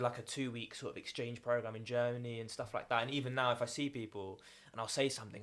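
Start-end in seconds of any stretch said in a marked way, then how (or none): none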